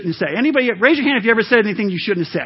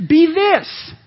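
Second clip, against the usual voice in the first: approving demo; 8 semitones higher than usual. Shown as a demonstration. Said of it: "acting out the right way".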